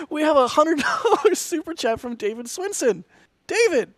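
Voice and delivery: high and loud with laughter breaking in; thrilled, gushing announcement; real delight reading out a hundred dollar super chat; excited. Real, not acted. Reading out the big donation.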